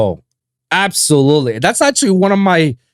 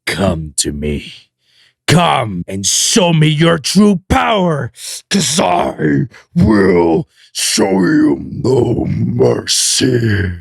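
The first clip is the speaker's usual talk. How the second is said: villain voice; much slower than usual. Shown as a demonstration. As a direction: threaten slowly like a villain. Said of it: "give you a little preview".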